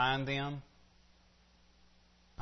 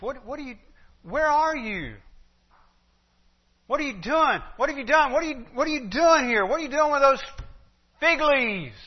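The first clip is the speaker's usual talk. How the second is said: much higher, louder insistent questions; God's searching question; 12 semitones higher, 8 dB louder than usual. Acted. God confronting Adam, high and loud. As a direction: press each question harder. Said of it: "That's the kind of question it is".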